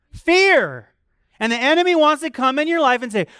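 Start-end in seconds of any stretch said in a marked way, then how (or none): 0.1-0.9 loud high punch on Fear; preacher's emphatic cry; shouted answer. Real, not acted. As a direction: hit the word hard.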